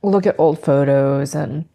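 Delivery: ordinary delivery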